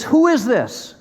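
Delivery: high, forceful demand; thundering question, stress up front; God challenging Job. Acted. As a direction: pitch it up as an indignant challenge. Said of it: "And he says".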